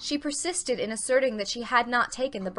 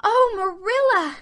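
much higher, louder, slow anguished cry; a girl's heartbroken gasp; 12 semitones higher, 8 dB louder than usual. A narrator's reading. Anne crying out as Marilla leaves, high anguished gasp. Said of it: "gasped Anne".

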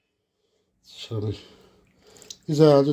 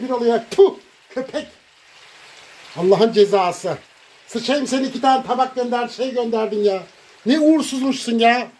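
raised, higher-pitched furious rant; angry ranting; 6 semitones higher than usual. Real, not acted. Ranting at the absent woman who sent plates, angry. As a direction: vent every grievance at her, still fuming. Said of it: "beni bağırttırıyor burada ya".

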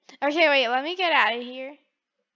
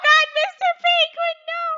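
high, loud pleading cry; mock panic; 12 semitones higher, 8 dB louder than usual. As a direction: cry out in hammed-up horror. Mock panic at game officer.

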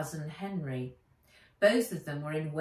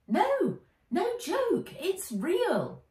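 put-on very high insistent boy; firm little boy; 13 semitones higher, 4 dB louder, much slower than usual. Henry insists.

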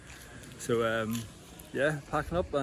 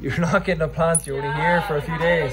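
loud retort; 3 semitones higher, 11 dB louder than usual. Real, not acted. Refusing friend's plant wish.

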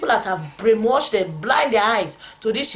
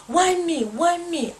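retold crying; 9 semitones higher, slower than usual. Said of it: "I was crying there".

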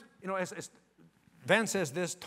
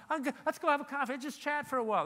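higher, quick casual invitation; George; 8 semitones higher, faster than usual. Retold or imitated. Salesman inviting someone for coffee, casual imitation. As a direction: lightly imitate a friendly, offhand chat opener. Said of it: "George talk".

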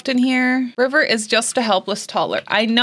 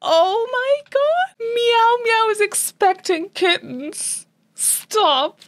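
ecstatic squeal; 10 semitones higher than usual. Real, not acted. Player learning her cat is expecting, high ecstatic squealing. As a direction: squeal like the best news ever. Pregnancy announcement joy.

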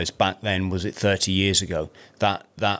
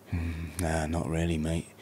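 downcast mumble; 5 semitones lower, 10 dB quieter than usual. Retold or imitated. Friend Rob answers, downcast.

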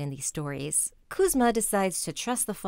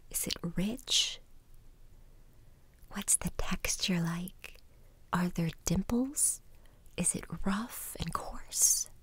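soft spa whisper; 5 dB quieter than usual. Acted. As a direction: whisper calmly, leading each breath. Guided mindfulness exercise in whisper.

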